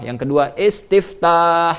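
"istiftah" stretched slowly to show its extra letters; drawn-out word. Shown as a demonstration. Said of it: "lebih banyak hurufnya".

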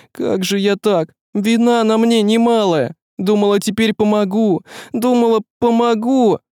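high-pitched, remorseful woman's voice; tearful self-blame. Acted. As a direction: confess as if close to tears.

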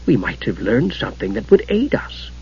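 very soft scholarly voice; Gillian. A narrator's reading. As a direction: offer it softly, barely voiced. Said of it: "the hitherto silent Gillian who spoke".

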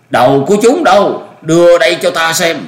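put-on high, loud commanding old master; peremptory demand. A narrator's reading. Old master demanding the severed heads, peremptory.

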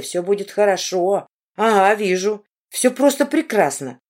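ordinary delivery, nothing marked

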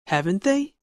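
"haven't they?" rises at the end; rising tune on "haven't they?"; rising tag question. Shown as a demonstration. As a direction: ask "haven't they?" as if unsure. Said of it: "falling intonation or rising intonation".

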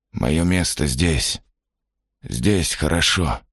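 slow and dreamy; slow, dazed. Acted. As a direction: drag the words, lost in thought. Man refuses, dazed.

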